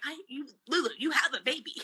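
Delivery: high-pitched mock scolding of oneself; exasperated self-reminder, retold. Retold self-scolding, ending in laugh.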